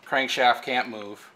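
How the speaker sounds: ordinary delivery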